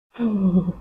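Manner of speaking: ordinary delivery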